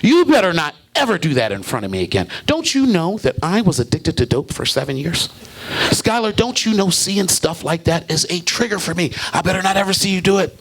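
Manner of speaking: lightly voiced big woman scolding; angry coworker. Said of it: "She said".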